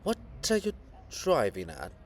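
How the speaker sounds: usual speaking voice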